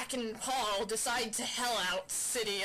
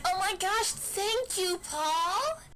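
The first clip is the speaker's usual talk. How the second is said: put-on girly gush; 8 semitones higher, 5 dB louder, slower than usual. Sarah voiced thanking her rescuer.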